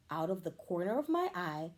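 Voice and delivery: ordinary delivery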